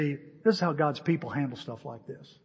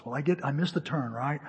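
ordinary talk, nothing marked

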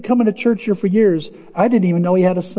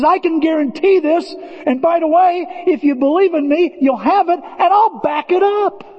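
high, earnest, slowing on the last words; emphatic Jesus voice; 10 semitones higher than usual. Acted. Pastor voicing Jesus to the congregation, high and earnest. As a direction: make the promise rock solid.

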